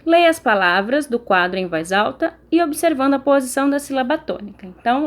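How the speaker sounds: ordinary talk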